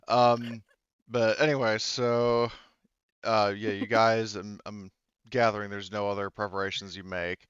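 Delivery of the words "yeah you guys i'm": ordinary talk, nothing marked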